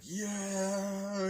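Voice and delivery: high, long-held "yeah"; imitated crowd cheer. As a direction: draw it out like a distant crowd. Crowd cheers as Mario walks out.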